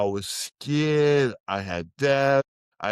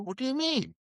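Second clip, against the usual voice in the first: much higher, puzzled disbelief; baffled question; 12 semitones higher, 4 dB quieter, faster than usual. Retold or imitated. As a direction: ask it as if the answer makes no sense. Reacting to friend's parole news.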